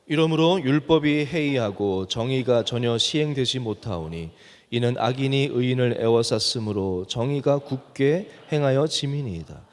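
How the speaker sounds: measured, solemn Bible reading voice; scripture reading. Acted. Preacher reads Habakkuk's lament to the congregation, solemn reading.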